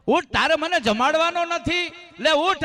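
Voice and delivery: put-on calling the girl to rise, voice lifted. Saint wakes sick girl lovingly. (ઊંચા અવાજે પ્રેમથી બોલાવવું)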